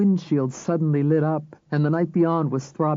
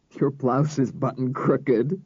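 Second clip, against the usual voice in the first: gasping with laughter; slower than usual. A narrator's reading. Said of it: "he gasped".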